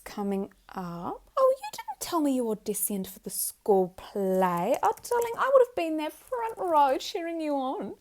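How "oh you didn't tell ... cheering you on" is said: loud, high burst of excitement; thrilled proud mother. Acted. Mother hears of child's school play audition, thrilled. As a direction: gush like their biggest cheerleader.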